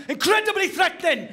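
high, loud mock menace; shouted threat. Shown as a demonstration. Comic shows a shouted Welsh accent sounds threatening. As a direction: bark it as a threat. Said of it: "But the moment it's shouted".

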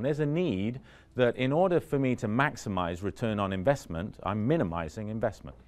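ordinary talk, nothing marked

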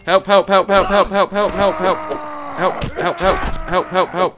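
hammed-up repeated yells for help; calling for help. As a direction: shout help over and over, playfully.

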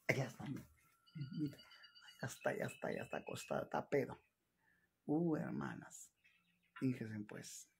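soft whisper so he won't wake. Real, not acted. Daughter whispers to viewers about her drunk father. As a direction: hush it, he's asleep.

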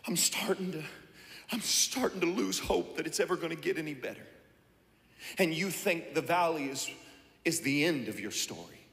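soft, hushed, hopeless murmur; hushed despair. Acted. Preacher voicing a despairing believer, hushed. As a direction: voice sinking into hopelessness.

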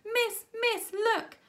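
put-on girl screaming to the teacher; screamed. A narrator's reading. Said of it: "screamed a girl".

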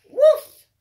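loud, high playful woofs; dog barks. Acted. Teacher barking for Biscuit the puppy, loud and playful. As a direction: bark like a happy puppy.

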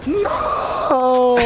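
high squealing laugh; voice-cracking scream laugh. Real, not acted. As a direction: laugh until the voice breaks. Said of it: "I just lost my voice there".

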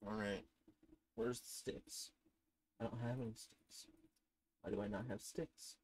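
soft, low whispered muttering; hushed grumbling whisper. Acted. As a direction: mutter it under your breath. Searching for sticks.

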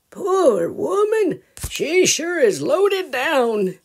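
high, slow, pitying voice; sympathetic grandpa. Acted. Grandpa pitying the loaded-down mother, high and slow. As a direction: cluck over her heavy load.

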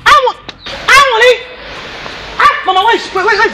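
angry. Acted. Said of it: "why are you so angry".

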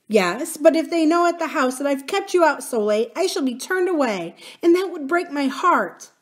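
high, upset woman's plaint; anxious nurse. Acted. Nurse dreading dismissal from the house, high and distressed. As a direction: fret aloud over losing her place.

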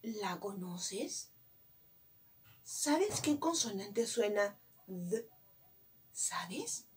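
very soft, coaxing questions; hushed questioning. Shown as a demonstration. Teacher quizzing a child on a letter sound, hushed. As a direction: drop to a hush, almost a whisper by the end.

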